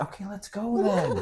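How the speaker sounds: high, drawn-out retold impatience; mock pleading push to get moving; impatient urging. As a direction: stretch it out as an exasperated prod. Urging his hesitant fiancée again.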